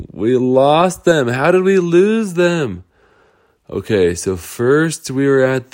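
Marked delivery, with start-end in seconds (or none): 0.1-2.8 higher, slower worried cry; a touch of alarm; mild panic. Acted. Girls realise they lost friends, mild panic.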